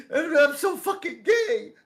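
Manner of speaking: high, loud sobbing confession; mock crying. Drunk friend comes out to his buddy, hammed-up crying. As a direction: wail it out, laughing underneath. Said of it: "Why are you fucking crying?".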